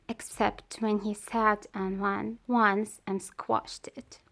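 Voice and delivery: ordinary delivery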